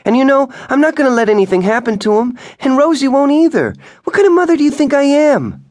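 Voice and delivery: wife screaming back at her husband; rising furious shout. A narrator's reading. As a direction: let the outrage build to the top.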